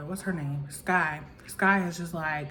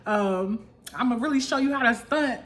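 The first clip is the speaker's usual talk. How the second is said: higher-pitched mimicry of a cocky challenger; playful mock call-out; imitated taunting call; 7 semitones higher than usual. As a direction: replay the taunt, amused. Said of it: "so funny how she said that".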